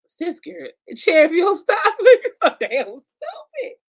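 loud high laughing tease; laughing scold. Real, not acted. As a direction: scold her while cracking up. Mock scolding her co-host laughing.

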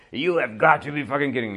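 quick mock yell of an angry hockey coach. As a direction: play the coach fuming at the bench. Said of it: "celou dobu řvou".

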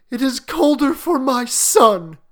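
put-on high, loud grieving old woman; a mother's anguished wail over her son; weeping. A narrator's reading. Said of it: "and wept afresh".

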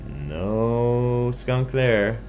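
high, loud, very stretched No; drawn-out searching. Acted. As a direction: sing out the empty result slowly. Pig searching, finding nobody.

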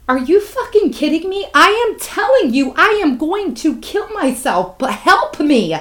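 high-pitched, incredulous retold outburst; outraged desperate plea. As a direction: plead in outrage, pitch jumping way up. Retold desperate plea to dismissive therapist.

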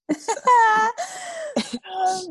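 high cackling laugh; loud squealing laugh. Real, not acted. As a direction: burst out laughing loudly.

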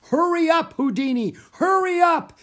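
very high, loud call; desperate cry. A narrator's reading. Manager calls to the missing star, crying out desperately. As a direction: call out to someone far away. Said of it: "he cried".

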